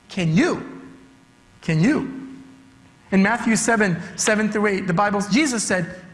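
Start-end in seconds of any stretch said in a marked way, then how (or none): none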